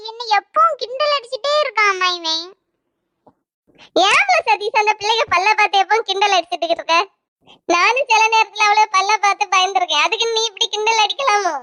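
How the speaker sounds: ordinary talk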